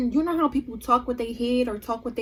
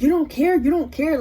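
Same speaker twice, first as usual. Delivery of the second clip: louder, insistent mock accusation; imitating angry friend; 3 semitones higher, 5 dB louder than usual. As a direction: mimic a friend getting mad. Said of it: "Like, she getting mad".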